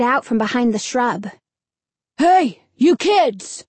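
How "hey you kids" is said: put-on gruff old man calling out. A narrator's reading. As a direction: bark it gruffly across the street. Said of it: "called to the boys in a gruff voice".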